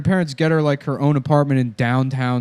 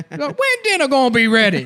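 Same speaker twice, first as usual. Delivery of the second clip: much higher, strained whiny voice; hammed-up high-pitched demand; mock daughter impression; 12 semitones higher than usual. Daughter from the film asking about dinner, mock high voice.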